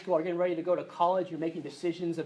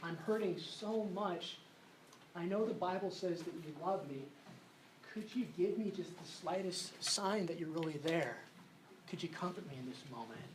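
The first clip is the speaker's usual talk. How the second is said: hushed desperate prayer; 3 semitones lower, 11 dB quieter than usual. Retold or imitated. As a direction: pray it quietly as if alone and hurting. Retold desperate prayer to God.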